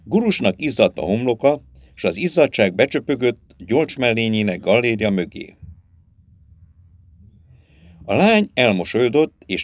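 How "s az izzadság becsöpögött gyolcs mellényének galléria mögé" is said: usual speaking voice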